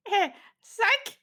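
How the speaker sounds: high mocking taunt; taunting NPC. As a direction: tease them, voice jumping up. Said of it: "he kind of looks at you and go".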